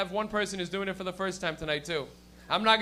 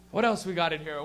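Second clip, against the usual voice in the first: ordinary talk, nothing marked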